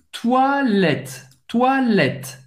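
"toilettes" slowly, ending on an audible T; sounded final T. Shown as a demonstration. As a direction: land on the T of "toilette", silent S. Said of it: "acaba no T, na pronúncia". ("toilette" terminando no som de T)

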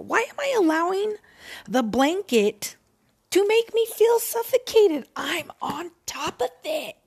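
high, loud disbelieving question; exasperated rant. Real, not acted. Ranting at herself.

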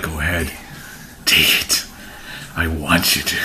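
menacing near-whisper invitation; 1 low menacing murmur. Retold or imitated. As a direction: dare them quietly, low. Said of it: "then Brock is like".

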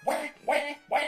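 high, loud repeated stabs; imitated stabby strings. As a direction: mimic screeching horror-film strings. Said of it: "all that stabby strings".

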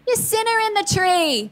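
high, mocking call-out; mock accusation. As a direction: jeer at him up in the tree. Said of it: "Sounds like a rap".

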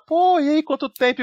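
high-pitched fake-friendly hello from someone half-remembered; overeager greeting. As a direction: play the too-friendly acquaintance. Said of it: "aí o fulano de tal".

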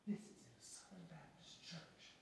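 hushed, amazed whisper; a hushed marveling aside. Retold or imitated. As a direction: whisper it like a secret you can't believe. Amazed aside about the church.

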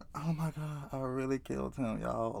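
quietly aghast; hushed shock. Retold or imitated. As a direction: say it small and high, stunned. Shocked at killing in dream.

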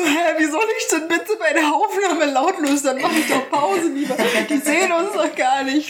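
high, laughing retelling of her confusion. Retold or imitated. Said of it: "Ich so".

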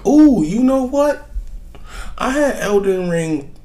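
as if a memory just hit you; sudden realization. Real, not acted. Remembering the GameStop reservation, a high surprised ooh. Sudden recollection mid-story.

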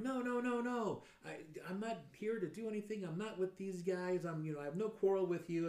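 high, fast, panicky denial; frantic pleading. Retold or imitated. GM voicing the player to the guards, high panicky pleading. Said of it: "you're doing like".

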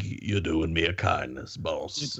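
gently reassure, low and fading on "boss"; John Coffey. Retold or imitated. Host as the condemned inmate to his guard, lightly imitated soft reply. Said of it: "he's like".

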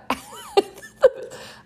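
bursting into a high laugh; high burst of laughter. Real, not acted. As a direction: crack up after the joke. Laughs at own joke.

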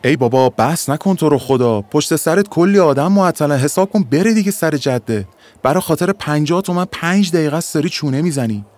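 loud, high, fed-up scolding; impatient clerk. Acted. Clerk to arguing passenger, loud impatient scolding. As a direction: tell him off, queue is waiting. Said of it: "متصدی گفت".

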